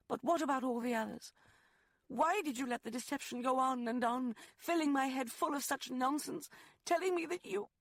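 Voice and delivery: high, loud and fast, cracking at the end; Rosalind, voice breaking. Acted. Woman accuses lover of deceiving her, voice breaking. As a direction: pour out the hurt until it breaks. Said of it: "Her voice broke".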